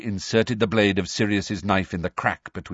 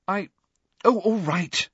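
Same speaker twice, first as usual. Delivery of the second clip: desperate; 10 semitones higher than usual. A narrator's reading. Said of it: "she said desperately".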